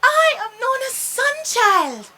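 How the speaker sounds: high and loud, full of delight; excited. A narrator's reading. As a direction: announce yourself with glee. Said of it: "Sunchild was so excited".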